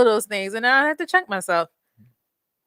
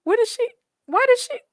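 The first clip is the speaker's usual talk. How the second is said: hushed what the fuck, then a squeak high up; baffled friend, voice jumping way up; mock shocked friend; stunned disbelief; 6 semitones higher, 5 dB quieter, slower than usual.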